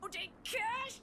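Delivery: high, whiny mock cry; a put-on crying wail. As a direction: whine it like a spoiled customer. Mocking the customer's cry.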